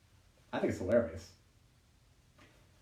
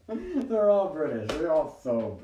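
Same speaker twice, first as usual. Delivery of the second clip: laughing exclamation; 8 semitones higher, 7 dB louder, slower than usual. Real, not acted. Laughing about British guys.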